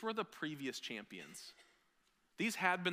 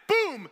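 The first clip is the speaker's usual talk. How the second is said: loud boom sound; 14 semitones higher, 14 dB louder than usual. Retold or imitated. Table-kicking sound effect.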